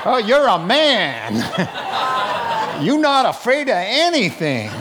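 sarcastic mock of a brave man, laughing; mocking macho. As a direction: tease him through laughter.

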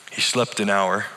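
ordinary delivery

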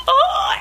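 high mock sob "oh"; long, high cry of being overcome; imitating your own emotional outburst; overwhelmed wail. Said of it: "an absolute just extreme emotion".

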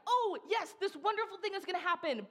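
high, gushing delight; mock excitement. Preacher voicing people expecting something wonderful, mock-thrilled. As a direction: exclaim it with giddy, hammed-up joy. Acts out hopeful anticipation, then disappointment.

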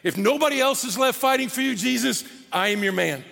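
brash and bold, pledge loyalty; cocky bravado. Acted. Peter boasting to Jesus he'll fight for him, cocky. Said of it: "confident, even cocky".

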